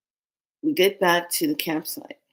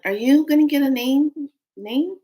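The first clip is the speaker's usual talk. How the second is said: higher, louder acted question; imitated eager question; 8 semitones higher, 5 dB louder than usual. People ask about getting a name, imitated eagerness. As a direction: play the keen questioners.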